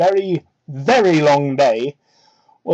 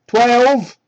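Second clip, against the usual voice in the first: louder and higher burst; disbelieving shout; 5 semitones higher, 6 dB louder than usual. Real, not acted. Reader shouts at the creepy fanfic detail. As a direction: blurt it out in disbelief.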